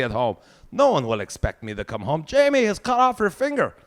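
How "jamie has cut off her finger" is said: higher, louder alarmed call; imagined family calling about an emergency; urgent caller. Pretend. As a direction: announce it like an emergency. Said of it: "imagined emergency call".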